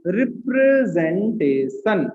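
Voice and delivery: drawn out syllable by syllable; slow dictation. Real, not acted. Teacher giving class the topic to write, slow dictation. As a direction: stretch each syllable for note-takers.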